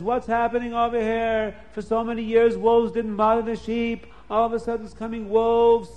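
bewildered man asking what happened; puzzled Lavan. Acted. Lavan puzzled by wolves attacking.

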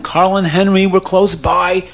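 ordinary delivery

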